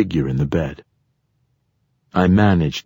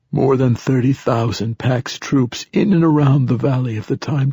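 put-on higher-pitched old man's voice; old man character, pitched up; old satyr poet; 6 semitones higher than usual. A narrator's reading. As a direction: voice an ancient poet foretelling events.

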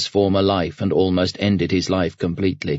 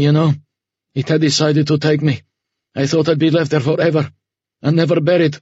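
higher-pitched, haunted confession in broken phrases; struggling to speak; 7 semitones higher, faster than usual. A narrator's reading. Ahmed confessing to Homer his terror at the station, struggling, higher voice. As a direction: force the words out haltingly, pitched up. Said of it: "He had to struggle to force the words out".

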